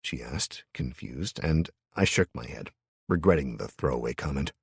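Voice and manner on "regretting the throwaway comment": ordinary delivery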